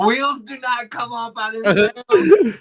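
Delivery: loud slow mock. Co-host piling on the joke, slow and loud. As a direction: drag it out in mock disbelief. Mocking agreement.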